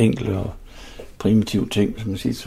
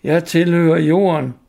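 louder, higher glad call; cheerful shout; 6 semitones higher, 4 dB louder, slower than usual. Acted. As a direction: call it out merrily. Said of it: "råbte med munterhed i stemmen". (munter råben)

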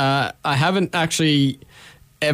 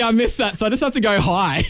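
normal talking voice

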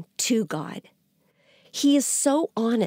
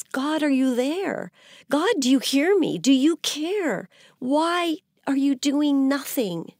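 shouting at God; 6 semitones higher than usual. Retold or imitated. Prophet demanding whether God hears or cares, mild mock shout. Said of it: "Habakkuk is actually shouting at God".

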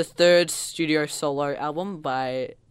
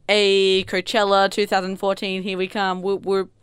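louder, raised cheer on one held note; mock festival hype; 4 semitones higher, 6 dB louder than usual. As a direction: hype the crowd as the star. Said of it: "So she's just like".

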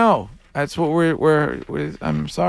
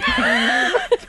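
burst of laughter. Real, not acted. Laughing at the caller.

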